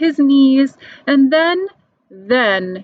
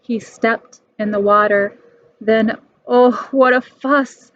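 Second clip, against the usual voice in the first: ordinary delivery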